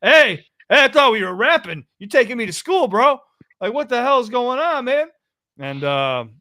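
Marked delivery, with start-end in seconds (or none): 0.0-5.1 much higher and louder, thrown and protesting; playing his shocked self getting schooled in a rap; mock bewildered protest. Retelling his reaction to a UK rapper, acting loudly bewildered. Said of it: "And I'm like".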